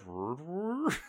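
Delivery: soft, tentative sounding-out; mouthing it out. Retold or imitated. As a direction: puzzle the words out under your breath. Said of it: "kind of mouthing it out".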